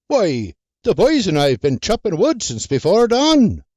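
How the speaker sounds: lightly voiced husband and brisk wife; kitchen banter. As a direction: tease and dismiss, brisk.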